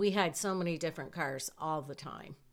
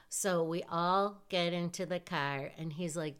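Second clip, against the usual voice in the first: ordinary talk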